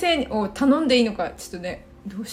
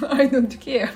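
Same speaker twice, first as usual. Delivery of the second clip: laughing phrase; 3 semitones higher, 4 dB louder, much slower than usual. Shown as a demonstration.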